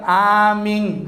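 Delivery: "Amin" sounded as "aming", ending on ng; congregation's drawn-out Bugis amin. Retold or imitated. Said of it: "kalau ngomong N jadi eng".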